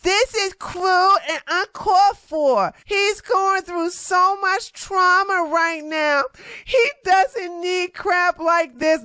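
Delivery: high, slow, prissy mock Karen voice. Mocking a Karen who scolded her online. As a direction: whine it out like an offended Karen. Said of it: "She got with her Karen ass".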